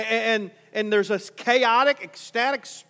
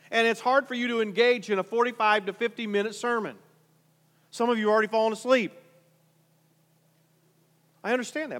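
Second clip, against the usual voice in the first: ordinary talk, nothing marked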